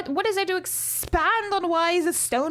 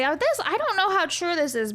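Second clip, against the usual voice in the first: ordinary talk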